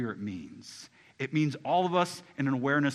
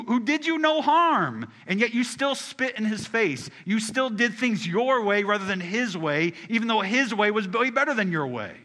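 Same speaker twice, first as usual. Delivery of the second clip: higher, louder impassioned preaching; preaching at full force; 8 semitones higher, 6 dB louder than usual. Acted. Pastor rebuking the congregation, rising to a loud preach. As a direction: lift it up and hammer the rebellion.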